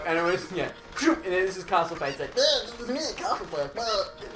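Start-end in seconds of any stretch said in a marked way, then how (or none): 2.4-4.3 lightly voiced eight-year-old boy; little boy voice. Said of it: "literally his voice as well".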